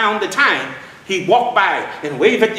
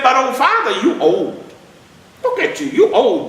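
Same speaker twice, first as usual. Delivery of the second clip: put-on high, cheeky child's voice; teasing little boy; 8 semitones higher than usual. Little boy teasing old father about his age, high and cheeky. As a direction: tease him like a bold kid.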